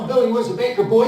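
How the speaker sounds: ordinary delivery, nothing marked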